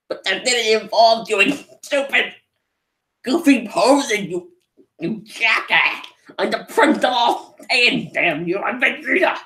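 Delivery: high, hammed-up proud prince voice; indignant Vegeta. Vegeta refusing the silly fusion dance, high and indignant. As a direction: play the offended Saiyan prince, over the top. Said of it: "And he's like".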